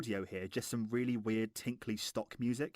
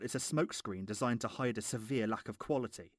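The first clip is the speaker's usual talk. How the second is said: ordinary delivery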